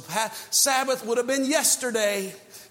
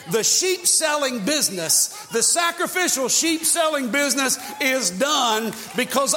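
loud and higher, declaring; fiery preaching; 4 semitones higher, 3 dB louder than usual. Real, not acted. Preacher declaring business is over, loud fiery proclamation. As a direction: hammer the verdict home.

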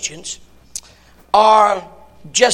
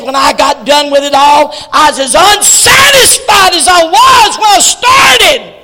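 loud, higher climactic shout; preaching shout; 6 semitones higher, 11 dB louder than usual. Acted. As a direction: cry it out to the congregation. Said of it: "And he said".

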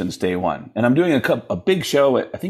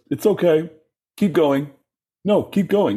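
higher-pitched dismissive rock-star impression; 7 semitones higher than usual. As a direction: brush off your bandmate in a mock high voice. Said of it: "[name] won't look at him. And he goes".

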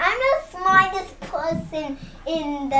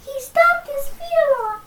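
higher, light little fairy voice; 7 semitones higher than usual. Acted. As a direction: sound like a tiny cute fairy. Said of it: "That is a cute little fairy voice".

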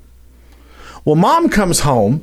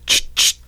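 shotgun pump sound; 3 dB quieter than usual. Retold or imitated.

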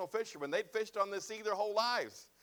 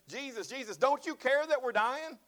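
panicked plea; 7 semitones higher, 4 dB louder than usual. Acted. Disciples crying out to sleeping Jesus, loud and panicked. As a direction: beg for help as the boat sinks.

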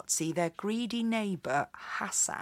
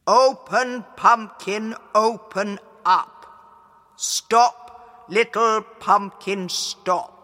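louder booming magic chant; genie; 6 dB louder, slower than usual. A narrator's reading. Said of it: "the djinn told her what to say".

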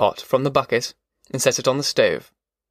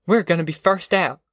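higher, mildly triumphant; a touch of exultation, pitched up; exultant; 5 semitones higher than usual. A narrator's reading. Ma as the truck starts up, mildly exultant. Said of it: "Ma said exultantly".

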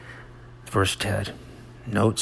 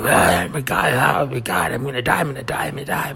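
higher-pitched mock panic, words tumbling out; hammed-up dying hysterics; dramatic; 4 semitones higher, 3 dB louder than usual. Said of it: "It's like dramatic".

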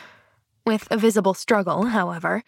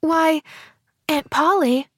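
put-on higher, mildly amazed child; a touch of stunned wonder, pitched up; breathed amazement; 7 semitones higher than usual. A narrator's reading. Pollyanna stunned by her aunt's outburst, mildly amazed. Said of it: "she breathed".

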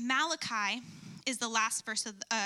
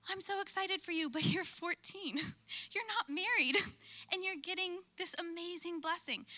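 excited friend; 6 semitones higher than usual. Retold or imitated. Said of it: "her possibly being like".

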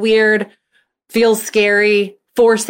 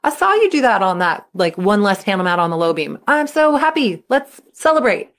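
excited cheer; 4 semitones higher than usual. Acted. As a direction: cheer your kid on like a proud mom. You're like.

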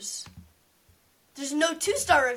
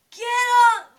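sudden loud yell; shouting; 9 semitones higher, 10 dB louder than usual. Retold or imitated. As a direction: bark the order. Bob yanked off sister, retold.

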